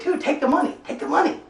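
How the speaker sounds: high-pitched, hushed urging; acted urging. Storyteller urging guy in front seat to take cash, urgent.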